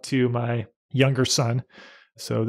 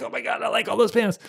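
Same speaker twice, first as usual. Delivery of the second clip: excited fan; 9 semitones higher than usual. Retold or imitated. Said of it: "I was like".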